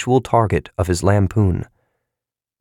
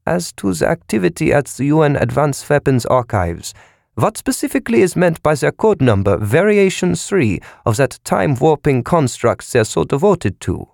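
clipped official; 7 semitones higher than usual. A narrator's reading. Von Einem questioning operator.

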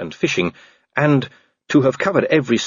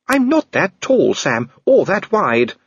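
much higher, slow, indignant lady's voice; Lady Sybil indignant; 11 semitones higher, 3 dB louder, slower than usual. A narrator's reading. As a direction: protest with wounded dignity.

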